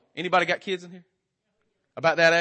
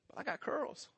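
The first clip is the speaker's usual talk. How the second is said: put-on tiny, high, soft girl voice; cute little daughter; 7 semitones higher, 17 dB quieter than usual. Daughter showing Daddy her curls, cutely pleading. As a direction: imitate a small child coyly pleading, high and hushed.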